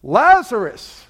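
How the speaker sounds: slow, commanding shout of the name; Jesus calling into the tomb, booming command; loud cry. Acted. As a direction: call him out of the tomb with full force. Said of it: "cries out, the Bible says, with a loud voice".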